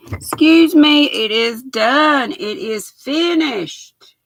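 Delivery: ordinary talk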